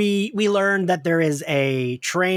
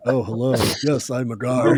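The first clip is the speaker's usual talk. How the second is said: Loki's top voice; 4 semitones lower than usual. Retold or imitated. Podcaster mimics Loki fooling the guard, putting on his top voice. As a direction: lower put-on voice, mock-suave. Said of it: "then uses his top voice".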